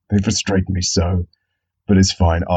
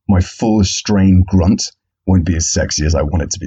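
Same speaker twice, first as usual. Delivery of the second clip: usual speaking voice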